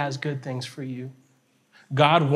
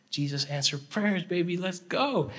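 slow, hushed celebration rising higher; hushed jubilation; 5 semitones higher, 4 dB quieter, slower than usual. Retold or imitated. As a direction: draw out a private victory cheer. Retelling his jubilant thought.

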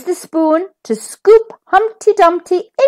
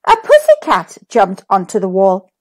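plain reading voice

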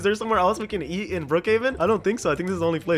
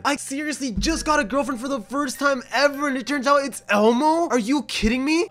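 high-pitched, incredulous rant; hammed-up bewildered outrage; mock disbelief; 4 semitones higher than usual. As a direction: rant as if utterly scandalized. Repeated mock outrage about Elmo girlfriend.

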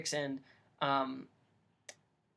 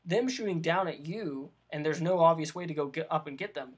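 usual speaking voice

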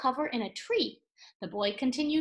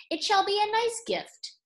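royal proclamation; 10 semitones higher, 4 dB louder than usual. Acted. King's proclamation, hesitant.

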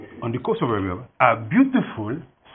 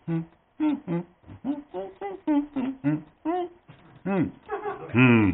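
thinking hums; 14 semitones higher, 4 dB quieter than usual. Acted. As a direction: hum while weighing it up. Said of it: "she thought about it a while".

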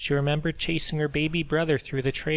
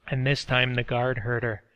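ordinary delivery

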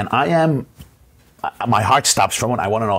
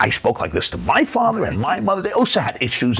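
indignant parent; 9 semitones higher than usual. Retold or imitated. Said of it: "And you think".

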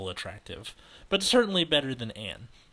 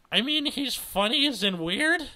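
high, condescending explanation; patronizing pitched-up voice; baby talk; 13 semitones higher, 5 dB louder than usual. Acted. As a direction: explain it as if to a small child. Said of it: "returning her tone to baby talk".

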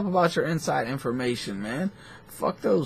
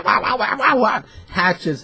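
high, loud chopping wah sounds; mock battle cry; 8 semitones higher, 5 dB louder than usual. Telling a friend about a wild fighter, high mock war cries. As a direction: mimic a hatchet frenzy. Imitating a savage hatchet attack.

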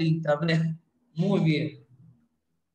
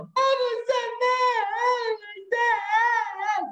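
high, hammed-up child's cry; mock wailing; 12 semitones higher than usual. The crying girl wails to her teacher, high and hammed-up. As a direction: wail like a little girl in tears. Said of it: "se ponía a llorar".

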